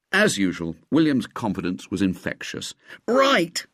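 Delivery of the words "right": high eager "right"; lightly voiced keen boy. A narrator's reading. Ginger agreeing to William, higher boy voice. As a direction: jump at the plan. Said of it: "said Ginger".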